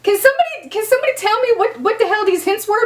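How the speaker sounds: high, fast incredulous challenge; comic exasperation, rushing the words; exasperated demand. Real, not acted. Challenging fans who claim hints.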